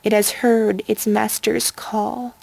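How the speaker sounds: ordinary delivery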